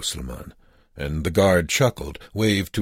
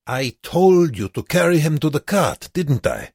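put-on high, loud accented whine of command; petulant; 11 semitones higher, 6 dB louder than usual. A narrator's reading. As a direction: scold like a thwarted guard. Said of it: "the guard said petulantly".

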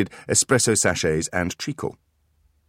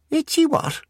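put-on high eager little-boy voice; a tiny child reaching for treats; toddler voice; 5 dB louder than usual. Beckett mishears shelves, high and eager.

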